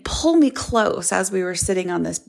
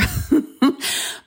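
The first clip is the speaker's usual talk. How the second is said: laugh; 7 semitones higher than usual. Real, not acted. Laughs at own confession.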